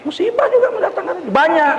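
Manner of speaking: high, giggling restart; laughing. Real, not acted. Preacher repeats his point to the congregation, giggling high. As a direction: laugh while repeating the line.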